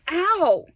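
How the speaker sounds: a sharp, high, loud yelp of pain; sudden ow. Retold or imitated. Telling his niece about a sudden sting, sharp high yelp. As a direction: yelp it like something just bit you.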